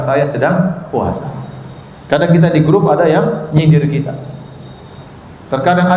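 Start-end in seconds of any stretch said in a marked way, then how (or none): 0.0-1.3 calm reply. Shown as a demonstration. Models calm reply to insult.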